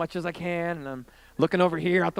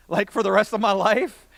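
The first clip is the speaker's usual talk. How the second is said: ordinary talk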